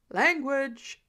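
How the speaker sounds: loud scolding call from the next room; yelled back. Acted. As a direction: shout the correction back. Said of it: "a new voice yelled back".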